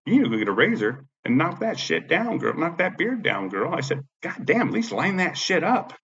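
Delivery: high-pitched, sassy retort; retold mocking comeback. Retelling his mocking comeback to her.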